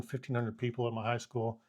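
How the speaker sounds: usual speaking voice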